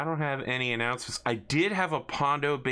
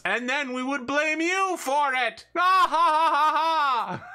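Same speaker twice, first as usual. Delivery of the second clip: much higher, far louder gloating cackle; evil villain cackle; 6 dB louder than usual. Acted. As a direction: throw back your head and cackle.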